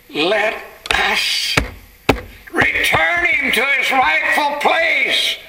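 high, drawn-out preacher's cry; impassioned plea. Acted. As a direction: plead fervently with a ringing high voice. Angel pleads with the Father.

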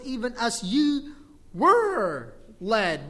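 "were" stretched long and loud; stress on WERE. Shown as a demonstration. Preacher stressing the past tense, drawn-out "were". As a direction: draw out "were" slowly and forcefully.

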